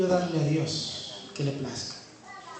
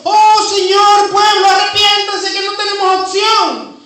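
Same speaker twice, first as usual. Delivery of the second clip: loud, much higher wail of doom; despairing cry; 16 dB louder than usual. Retold or imitated. Isaiah crying out in despair before God's glory, high and loud. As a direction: cry out as if all is lost. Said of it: "Yo me imagino entonces a Isaías diciendo".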